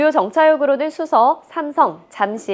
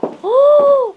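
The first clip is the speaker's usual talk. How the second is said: big high gasp, louder; loud amazed gasp; 14 semitones higher, 6 dB louder than usual. Acted. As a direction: gasp in over-the-top amazement. Gasps entering dress room.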